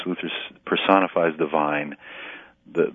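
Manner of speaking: ordinary talk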